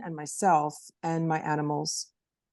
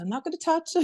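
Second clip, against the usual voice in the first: reassuring her daughter; 11 semitones higher, faster than usual. Retold or imitated. Mom reassuring daughter, retold.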